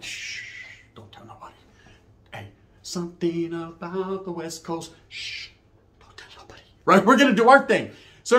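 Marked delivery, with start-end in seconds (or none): 0.0-6.6 whispered shush, slow and secretive. Retold or imitated. Speaker shushing his homeboy in the car, whispered. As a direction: let it be our little secret.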